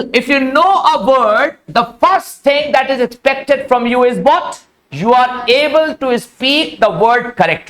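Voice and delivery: words spaced out very slowly; slow teacher delivery. Shown as a demonstration. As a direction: lay it out slowly for the class. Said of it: "slow instructive delivery". (बहुत धीमे समझाते हुए)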